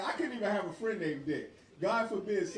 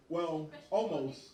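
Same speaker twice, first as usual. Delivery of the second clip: usual speaking voice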